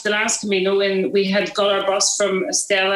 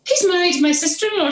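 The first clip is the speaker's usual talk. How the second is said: excited exclamation; 10 semitones higher, faster than usual. Retold or imitated. Friend explains she knows him.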